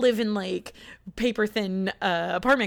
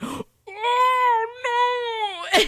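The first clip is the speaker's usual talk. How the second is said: sharp gasp then a high, louder "no!"; panicked gasp; 13 semitones higher, 3 dB louder than usual. Retold or imitated. Realizing God might be real, gasping in mock panic. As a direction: gasp and cry out in mock horror.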